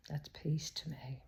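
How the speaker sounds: slow, soft breathy whisper. Real, not acted. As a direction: hush it, savouring the quiet. Savouring silence after long pause.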